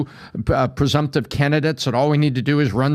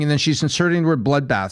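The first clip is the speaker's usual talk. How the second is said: ordinary delivery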